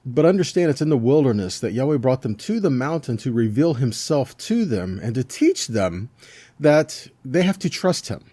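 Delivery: ordinary talk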